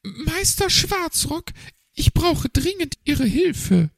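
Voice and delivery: high, pleading magpie voice; urgent magpie. A narrator's reading. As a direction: beg the raven for help, up high. Magpie urgently calls raven.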